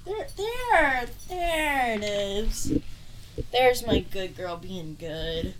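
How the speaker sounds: slow, drawn-out pet praise; praising the dog. Real, not acted. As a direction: praise a dog fondly, stretching the words.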